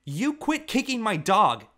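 little brother yelling furiously at Travis; fierce yell. A narrator's reading. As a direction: yell it fiercely to protect the dog. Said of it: "he yelled fiercely".

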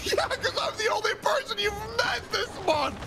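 high laughing yell; laughing shout. Real, not acted.

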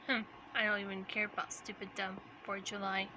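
low, quick sulky grumble; grumpy Shadow. Acted. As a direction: grumble it as the moody loner. Shadow character grumbles, voiced differently.

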